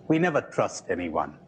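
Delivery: ordinary talk, nothing marked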